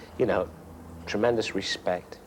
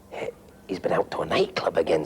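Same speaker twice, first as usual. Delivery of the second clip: mock whisper; 4 semitones lower than usual. Shankly confiding about Bobby Moore.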